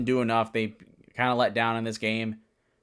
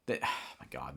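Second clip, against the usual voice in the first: exasperated sigh; 12 semitones lower, 12 dB quieter than usual. Real, not acted. Sighing at the Jets.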